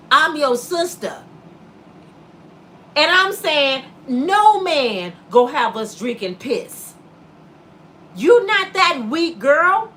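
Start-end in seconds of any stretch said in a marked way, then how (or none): none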